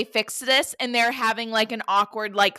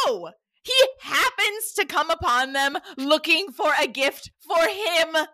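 mock outraged rant; 6 semitones higher than usual. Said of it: "I was like".

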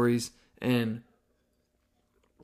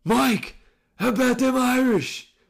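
imitating a taunting bartender, much higher and louder; bartender yelling; 11 semitones higher, 5 dB louder than usual. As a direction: call across the bar to needle him. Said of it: "a bartender yells over".

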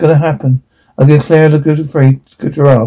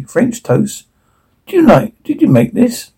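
lightly voiced young girl, higher and eager; 8 semitones higher than usual. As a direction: ask like an eager little girl, pitched up. Girl's excited breakfast questions.

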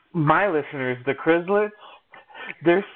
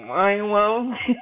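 laughing; 7 semitones higher, slower than usual. Pretend. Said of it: "mock fan declaration".

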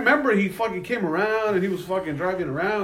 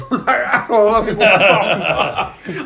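louder, higher, laughing through the words; 5 semitones higher, 5 dB louder than usual. Real, not acted. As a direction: crack up while complaining. Laughing at his own ordeal.

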